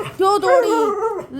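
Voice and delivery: high, slow, drawn-out whine; dog's complaint. Pretend. Mother voicing her dog's slow whining complaint. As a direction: stretch it out like a sulking dog.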